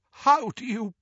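high, strained croak; shocked, choked question. A narrator's reading. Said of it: "croaked Doge".

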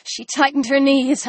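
ordinary delivery, nothing marked

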